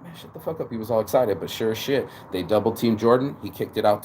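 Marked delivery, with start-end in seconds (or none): 0.0-0.7 hushed rebuke. Retold or imitated. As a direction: hush him up quietly. Jordan hushing an eager teammate.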